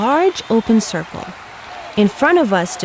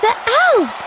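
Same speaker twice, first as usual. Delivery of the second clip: put-on high excited child's shout. A narrator's reading. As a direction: call it out in delight. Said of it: "shouted one of the children".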